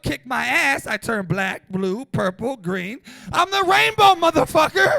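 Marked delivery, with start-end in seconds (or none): 3.3-4.9 high, loud declaration; shouted punchline. Real, not acted. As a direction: proclaim it triumphantly. Punchline yelled at crowd.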